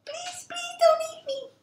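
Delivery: very high, tiny pleading voice; squeaky-high begging; frightened little billy goat. Acted. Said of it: "he shuts his eyes really tight".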